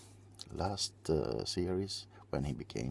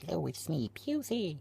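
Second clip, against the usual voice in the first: high character voice; 13 semitones higher than usual. Acted. Greets as a character.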